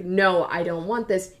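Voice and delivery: ordinary delivery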